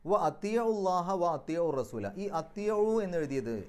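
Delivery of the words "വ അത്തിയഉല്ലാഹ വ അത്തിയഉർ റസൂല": slow, raised chant; Quranic recitation. Shown as a demonstration. Teacher recites the verse to the class, chanted. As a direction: intone the verse, each word held. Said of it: "recites verse for class".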